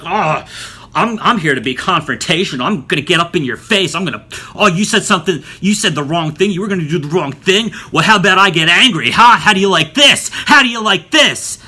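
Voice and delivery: confrontational mock rant. Instructor snapping at a nervous student, mock confrontational and loud. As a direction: get in their face with a mocking, escalating tirade. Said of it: "getting all confrontational".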